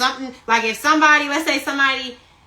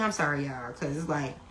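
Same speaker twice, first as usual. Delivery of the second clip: sheepish apology; 11 semitones lower, 15 dB quieter, faster than usual. Real, not acted. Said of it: "Let me stop saying it".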